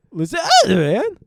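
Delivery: high, loud, drawn-out mock kid voice; nine-year-old tough guy. Co-host correcting the child impression, slow high squawk. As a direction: stretch it slowly, high and loud. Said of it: "Actually, it's like".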